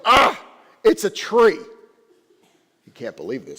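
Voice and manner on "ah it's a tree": dismissive students. Acted. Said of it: "They go".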